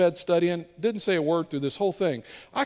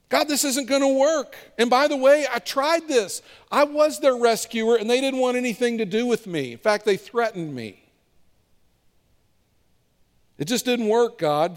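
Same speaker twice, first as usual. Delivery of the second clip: Moses protesting; 6 semitones higher than usual. Acted. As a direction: argue with God like a worn-out leader. Moses voiced protesting to God.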